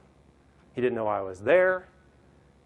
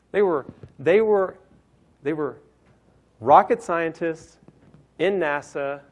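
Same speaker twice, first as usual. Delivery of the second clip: emphatic build; 6 semitones higher, 9 dB louder, slower than usual. Real, not acted. Building up the founders' credentials.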